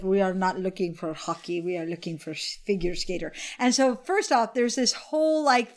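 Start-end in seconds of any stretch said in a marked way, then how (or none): 0.0-3.3 lower, softer stern coach; a hint of a stern Russian coach. Coach turning down the hockey player, lightly stern and lower. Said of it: "He's like".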